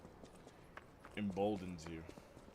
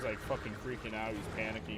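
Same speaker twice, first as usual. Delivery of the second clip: usual speaking voice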